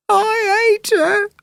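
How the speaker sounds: high, slow sulky sob voice; tearful child. Acted. Little Jill tells her sister she hates Megan, high and tearful. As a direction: sulk it through tears.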